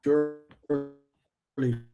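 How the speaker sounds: ordinary talk, nothing marked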